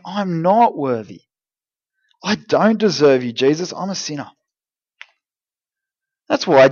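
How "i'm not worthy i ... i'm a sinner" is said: acted humble confession. Sinner coming to Jesus undeserving, acted humble confession. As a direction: confess it humbly, without any push. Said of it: "You come to Jesus saying".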